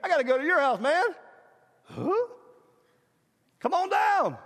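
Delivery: high-pitched holler up to someone; acted calling out. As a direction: call him down, voice lifted high. Acts Jesus calling Zacchaeus down.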